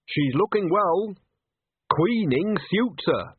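put-on coaxing old witch. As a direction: gently test the waters. Nanny probes Granny.

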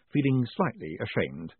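plain reading voice

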